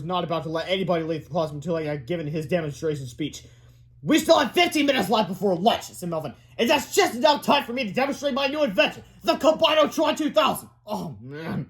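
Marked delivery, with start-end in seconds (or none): 4.0-5.8 put-on high-pitched brainy kid voice; insistent schoolboy. A narrator's reading. Boy insisting on his speech. 6.6-10.7 put-on high, proud announcer-like kid voice; boy inventor announcing. A narrator's reading. 10.9-11.7 soft, disappointed whine; whined. A narrator's reading. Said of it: "whined Melvin class".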